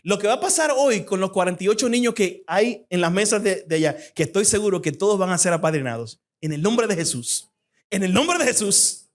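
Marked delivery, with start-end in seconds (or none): none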